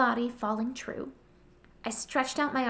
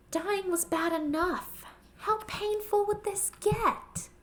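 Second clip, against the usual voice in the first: higher, slow, strained voice of dread; anguished dread; 9 semitones higher, slower than usual. Acted. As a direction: let the fear of pain creep up slowly. Dying narrator's anguished thought.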